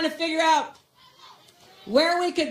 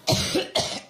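stifled cough; coughing fit. Real, not acted. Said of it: "excuse me".

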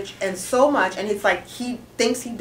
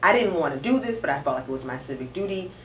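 soft, restrained retelling; imitated measured testimony; 5 semitones lower, 6 dB quieter than usual. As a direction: hold it in, quietly pushing through. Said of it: "She was crying, but it was very like".

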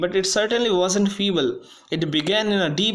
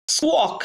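high screechy squawk imitating a violin; shrill violin squawk; 9 semitones higher than usual. A narrator's reading. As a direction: screech it like a badly played violin.

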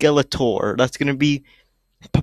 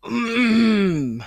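high, pained whimpering moan; distressed whimper; 9 semitones higher, 4 dB louder than usual. Real, not acted. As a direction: whimper in disgust. Whimper at disgusting image.

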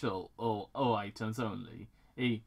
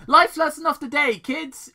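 high, loud mock-preachy call; announcer shout; 13 semitones higher, 13 dB louder than usual. Real, not acted. Reader addressing the kids in his audience, loudly. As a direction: bellow it like a TV moral.

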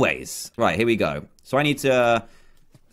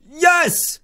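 excited yes; 13 semitones higher than usual. Real, not acted. Clearing a tough parkour jump.

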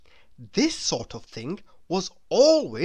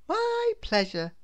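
woman's voice. Acted.

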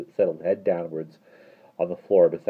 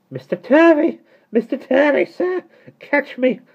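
very high, loud cries for help; panicked cries; 9 dB louder, slower than usual. A narrator's reading. Miss Tartlett lifted off the floor, panicking. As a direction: cry out in wild alarm. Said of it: "cried Miss Tarlett".

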